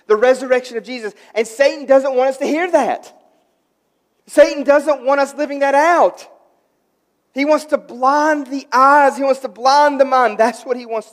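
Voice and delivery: loud, high, emphatic pulpit declaration; full-force preaching. Real, not acted. Pastor warning church about Satan's blinding, forceful preaching. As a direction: drive each phrase home at the top of your preaching voice.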